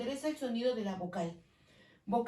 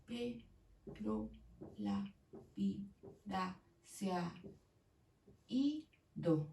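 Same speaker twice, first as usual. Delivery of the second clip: very slow and quiet, syllable by syllable; whisper-soft, spacing "Pero la vida se ha ido"; slow syllable count; 2 semitones lower, 7 dB quieter, much slower than usual. Shown as a demonstration. Teacher counting syllables slowly for students. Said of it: "Son ocho sílabas".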